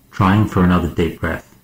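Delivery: ordinary delivery, nothing marked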